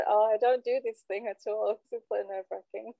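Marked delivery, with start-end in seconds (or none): 0.1-1.8 nervous laughing. Retold or imitated. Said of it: "I am so nervous".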